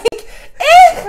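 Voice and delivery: high, loud mock whine; squealed bratty eh; whiny eh. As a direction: whine like a sulky teen. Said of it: "And I'm like".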